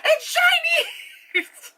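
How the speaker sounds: high mock squeal dissolving into laughter; exclaiming old lady. Repeating the old lady's remark, high laughing exclamation. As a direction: mimic the old lady while cracking up. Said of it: "She's like".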